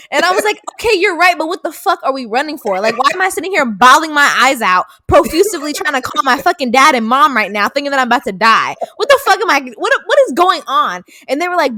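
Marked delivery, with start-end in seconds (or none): 0.7-11.0 raised, loud mock exasperation; hammed-up bewildered outburst, pitched high; retold frantic protest. Retelling her demand for answers from strangers, frantic protest.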